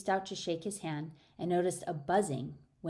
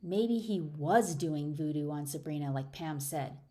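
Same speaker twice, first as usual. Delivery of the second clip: usual speaking voice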